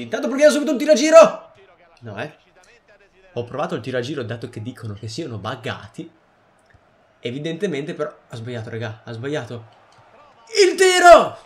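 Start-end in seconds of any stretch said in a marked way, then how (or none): 0.0-1.5 excited shout. Real, not acted. Shouting at game shot. 10.6-11.4 shouted, much higher, much louder; excited shout. Real, not acted. Gamer shouts at shot.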